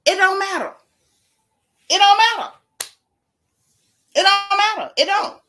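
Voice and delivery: high, loud It don't matter, repeated; loud fed-up declaration. Real, not acted. Fed up with the drama, yelling it don't matter.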